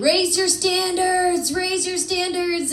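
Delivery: high, slow mock motivational chant; self-help voice. Self-help books always telling you, preachy chant. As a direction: intone it high, like a slogan.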